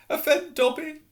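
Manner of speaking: very high, choked elf cry. Acted. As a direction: gasp it out through tears. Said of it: "choked the elf".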